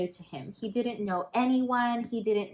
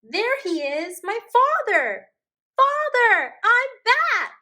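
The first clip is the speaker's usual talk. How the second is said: much higher, much louder joyful call; excited calling; 13 semitones higher, 8 dB louder than usual. Acted. Lars spotting his father and calling out, high joyful shout. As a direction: shout across the ice to your dad. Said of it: "Lars called".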